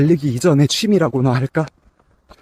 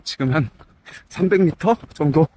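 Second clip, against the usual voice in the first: talking mid-jog, a bit winded; while running. Real, not acted. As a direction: speak while running at an easy pace. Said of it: "이제 막 뛰기 시작했고".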